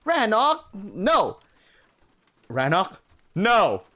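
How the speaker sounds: high loud pleading shouts; laughing terrified warning; panicked yelling. Acted. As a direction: shout him off in mock panic. Wolf about to hug her.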